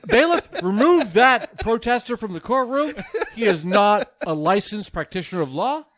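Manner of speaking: booming through laughter, southern judge out of control; loud laughing order. Acted. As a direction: bark the bailiff order while cracking up. Judge laughing, ejecting protester.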